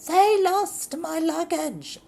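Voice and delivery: high, loud falsetto complaint; mock lady voice. Lady complaining the airline lost her luggage. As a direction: whine it like a diva.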